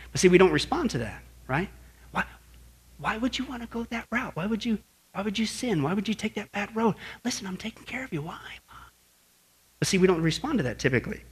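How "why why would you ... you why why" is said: hushed, higher pleading voice; softly plead, fading into a whisper. Acted. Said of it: "He whispers".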